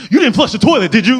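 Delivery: high, loud accusing yell; host bursting out of the corner, shrieking high and loud at the guest; accusing shout. Retold or imitated.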